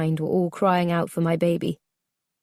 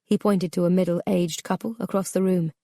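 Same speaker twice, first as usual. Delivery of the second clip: plain reading voice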